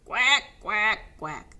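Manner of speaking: loud quacking that fades away; calling quacks. A narrator's reading. Ducks calling quacks as they plop in. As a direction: call out quacks into the pond. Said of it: "they called".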